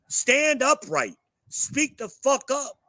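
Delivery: loud, high, slow shouted command; furious yelled demand to speak up; angry shout. Real, not acted. Mocks soft-talking young drivers.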